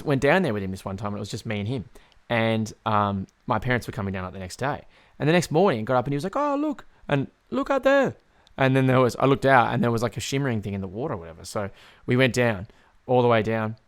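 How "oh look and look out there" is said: high, put-on grandpa voice; high-pitched impression of an old Hungarian man; excited old pop. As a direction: imitate grandpa excitedly pointing something out. Imitates excited Hungarian pop pointing out.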